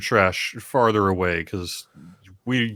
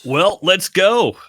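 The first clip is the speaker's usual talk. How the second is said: rousing call; 10 semitones higher, 7 dB louder, slower than usual. Real, not acted. Rallying the party to move.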